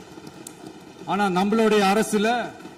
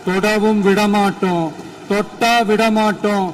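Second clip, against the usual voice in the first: loud emphatic vow; 5 dB louder than usual. Real, not acted. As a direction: pound out each refusal, building to the last. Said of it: "கொஞ்சம் சவுண்டா பேசுறேன்". (சத்தமா, அழுத்தி சொல்லும் சூளுரை)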